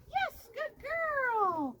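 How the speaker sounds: slow, high, sing-song praise for the dog; drawn-out praise. Real, not acted. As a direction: stretch out the praise to reward her.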